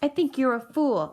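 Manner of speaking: ordinary delivery, nothing marked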